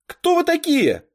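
high, loud startled demand; alarmed demand. A narrator's reading. Girl jumps up at two boys in her room, alarmed shout. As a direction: challenge the intruders, pitched right up and loud.

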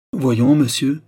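impatient royal insistence; insistent. A narrator's reading. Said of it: "insista le roi".

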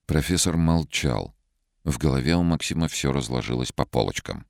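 usual speaking voice

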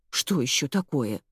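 plain reading voice

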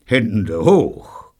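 mildly growled command; restrained, grim hold-up order. A narrator's reading. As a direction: growl it grimly, without shouting. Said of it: "knurrte Neil grimmig".

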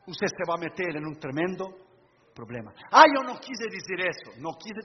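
ordinary delivery

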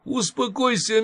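sugary soothing old nanny, lightly voiced; cooing nanny. A narrator's reading. Nanny soothing the patient, a mild cooing voice. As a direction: coax her like a sweet old nurse. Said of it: "заувещевала нянька".

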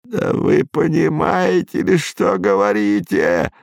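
put-on despairing old man's voice; a frail old man, almost desperate. A narrator's reading. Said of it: "с каким-то даже отчаянием".

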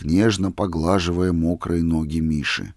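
ordinary delivery, nothing marked

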